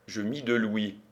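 usual speaking voice

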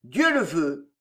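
low, savage fervour. A narrator's reading. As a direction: declare it fiercely, pitched low. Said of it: "avec un accent sauvage". (accent sauvage, grave et farouche)

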